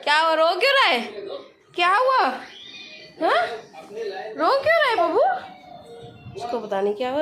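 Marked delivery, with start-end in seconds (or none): 0.0-5.2 concerned questioning. Real, not acted. Mother questions her crying child. (परेशान माँ की ऊँची आवाज़)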